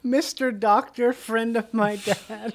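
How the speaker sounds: slow, high drawn-out promo read; hammed-up slow announcer; mock announcer echo. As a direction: savour the title like a trailer voice. Echoing the promo voice.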